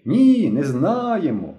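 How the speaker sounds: high, drawn-out contradicting cry; stretched-out mild shout; children clamouring. A narrator's reading. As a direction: call it out slowly like a crowd of children. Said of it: "їх голоси потонули у загальному галасі інших дітей".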